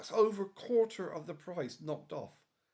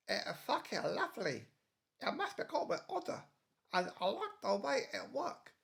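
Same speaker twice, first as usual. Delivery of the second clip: lightly put-on Young Ones punk voice; a hint of Rick's sneering student whine; 3 semitones higher than usual. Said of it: "suddenly I sound like Rick from The Young Ones".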